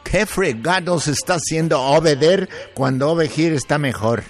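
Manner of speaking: usual speaking voice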